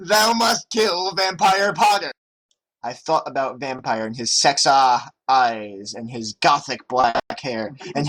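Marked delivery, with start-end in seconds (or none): 0.1-2.1 yelled command. A narrator's reading. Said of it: "He yelled".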